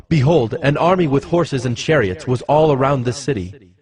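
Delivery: ordinary talk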